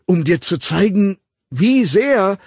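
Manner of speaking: high, loud, urgent build-up; desperate confession. A narrator's reading. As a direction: push toward the confession until cut off.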